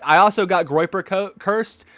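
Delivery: ordinary delivery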